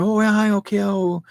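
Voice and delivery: high, mocking eager children; mock eager kids. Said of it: "other kids are going".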